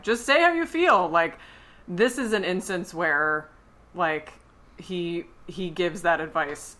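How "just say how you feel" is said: exasperated plea. Retold or imitated. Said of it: "I'm like".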